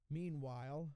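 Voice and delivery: ordinary delivery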